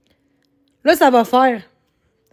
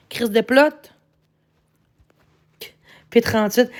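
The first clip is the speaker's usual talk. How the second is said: ordinary talk, nothing marked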